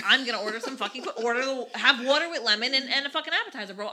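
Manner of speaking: loud, heated retelling of a comeback; indignant retort. Retold or imitated. Woman retelling her answer to a cheap date, indignant.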